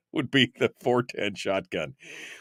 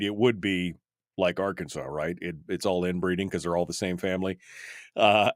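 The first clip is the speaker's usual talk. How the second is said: ordinary delivery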